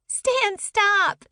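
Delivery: high squealing shriek through laughter. Acted. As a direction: squeal it out while being tickled.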